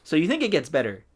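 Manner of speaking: ordinary talk, nothing marked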